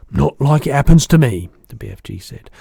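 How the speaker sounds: loud giant character, self-pitying; rueful BFG. A narrator's reading. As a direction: protest loudly as a hard-done-by giant. Giant laments his bad speech.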